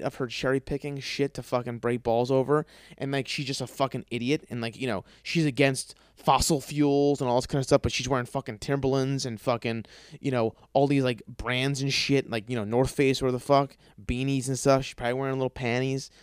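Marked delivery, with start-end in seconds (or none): none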